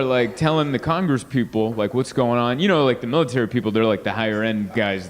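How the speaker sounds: usual speaking voice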